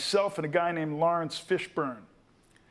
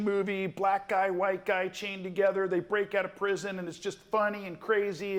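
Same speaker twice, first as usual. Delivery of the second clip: much higher, chummy fast-talking producer voice; Hollywood producer; 4 semitones higher than usual. Retold or imitated. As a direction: sell it like a slick Hollywood agent on the phone. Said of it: "this guy calls me up and he goes".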